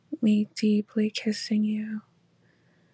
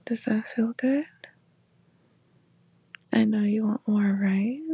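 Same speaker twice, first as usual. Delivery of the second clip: right in your ear. Acted. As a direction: close intimate whisper. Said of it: "my voice in your ear".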